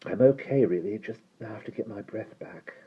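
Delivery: high, soft and short of breath; winded reassurance. Acted. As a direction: insist faintly while getting your breath. Winded boy reassures his friend.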